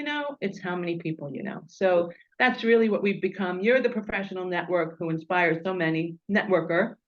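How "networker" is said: usual speaking voice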